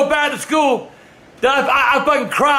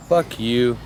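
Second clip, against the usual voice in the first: grumpy barfly; 12 semitones lower, 5 dB quieter than usual. Retold or imitated. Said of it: "Motherfucker sitting in the bar going".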